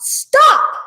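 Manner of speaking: high, loud shouted command; sudden high-pitched yell. A narrator's reading. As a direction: shout it out to halt a squabble. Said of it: "the chief frog shouted out".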